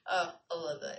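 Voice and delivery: ordinary talk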